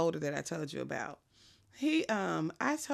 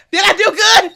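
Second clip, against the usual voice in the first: much higher, shouting through laughter; laughing shout; 13 semitones higher, 20 dB louder than usual. Retold or imitated. Partner proud of his slap, shouting through laughter. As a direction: shout it proudly while cracking up. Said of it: "And he is like".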